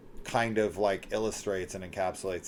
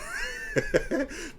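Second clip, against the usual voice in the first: very high-pitched laugh; real giggling. Real, not acted. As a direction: squeal with laughter at your own bit. Laughs at own impression.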